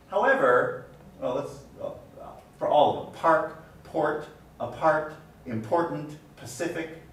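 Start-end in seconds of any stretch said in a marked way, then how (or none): none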